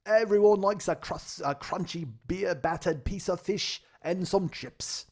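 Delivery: booming, drawn-out fish and chip ad in a mock British accent; loud, high, slow hammed-up British pitchman; British character voice. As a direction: sell it in a cartoonish British accent. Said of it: "that's my character".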